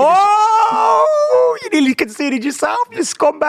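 high, loud outraged yell; excited shout. Real, not acted. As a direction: shout at the screen. Near own goal, shouting.

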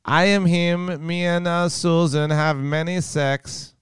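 put-on high, slow Swedish boyfriend accent. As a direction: croon it as a cheesy Swedish lover. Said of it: "how was my accent".

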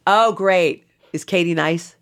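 loud, high, gushing stranger; starstruck seatmate. Retold or imitated. Seatmate asking about Katie, loud and eager. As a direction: gush loudly at the celebrity connection.

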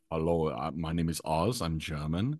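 a low, quiet send-up of Oz introducing himself; lightly voiced German guy; imitating Oz. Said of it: "He's like".

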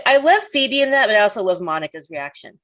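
ordinary talk

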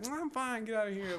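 high, soft brushing-off reply. Retold or imitated. Coworkers ask if she's okay, she brushes it off lightly and high. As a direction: wave off the worry, lightly and high. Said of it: "I'm like".

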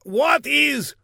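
high, loud, slow eager shout; excited shout. Acted. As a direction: blurt it like a quiz contestant. Guard excitedly answers quiz.

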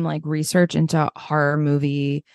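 ordinary delivery

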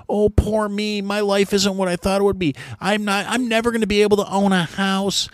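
high whiny mockery of a self-pitying kid. Ranter mocking Gen Z complainers in a high whiny voice. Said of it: "you got these whiny".

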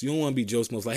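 usual speaking voice

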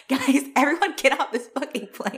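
high, hammed-up warning cry; mock alarm. Self-declared witch warning fellow passengers, in mock alarm. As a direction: playfully urge everyone to flee. Imagines warning everyone off plane.

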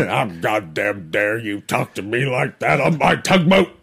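put-on gruff old sea captain; angry old tugboat captain; old boat captain. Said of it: "Be an old boat captain".

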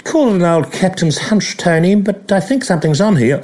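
high, dry commentator voice; a knowing, understated commentary hunch; Richie Benaud impression. Mimicking Benaud to Tony Greig on air. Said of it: "he said".

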